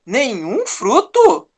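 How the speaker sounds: slow, sly serpent asking Nenhum fruto; a light hint of the serpent, sowing doubt; serpent's doubting question. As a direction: drawl it out like a tempting snake. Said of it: "bem provável que ela tenha falado assim".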